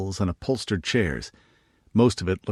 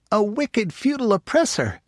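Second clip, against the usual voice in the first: put-on high, thrilled child voice; delighted little girl; 14 semitones higher than usual. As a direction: squeal it with delight like a young girl. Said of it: "Janey said delightedly".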